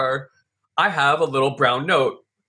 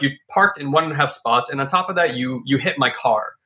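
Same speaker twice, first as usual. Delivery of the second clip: usual speaking voice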